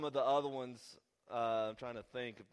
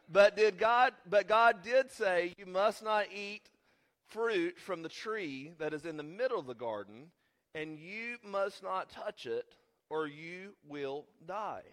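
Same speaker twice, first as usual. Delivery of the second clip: forceful, high preaching voice, loud at the start; 7 semitones higher, 9 dB louder, slower than usual. Real, not acted. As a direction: proclaim the scripture slowly and emphatically.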